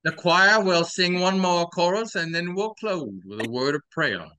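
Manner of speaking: Southern preacher, booming start. Pretend. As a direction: close the service like a pastor. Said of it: "I feel like a pastor".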